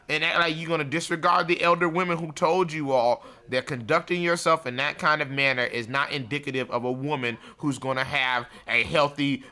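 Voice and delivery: normal talking voice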